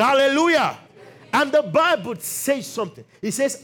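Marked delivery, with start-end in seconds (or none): 0.0-0.8 high, loud insistent hallelujah; real rallying shout. Real, not acted. Preacher prompting congregation to respond, real rallying shout. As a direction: demand a louder answer from the crowd.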